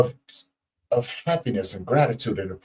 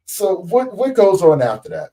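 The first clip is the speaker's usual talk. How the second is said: high, loud question falling at the end; raised, emphatic question; 7 semitones higher, 5 dB louder than usual. Real, not acted. Host prompting patient for the next step, raised emphatic question. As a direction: push the question out high and strong.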